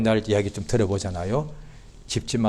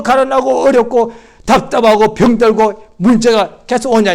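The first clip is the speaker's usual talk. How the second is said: loud, high acted protest, building in force; aggrieved complaint; 10 semitones higher, 9 dB louder than usual. Faithful believer protesting unanswered hardship, aggrieved complaint. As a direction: pile up grievances at full voice.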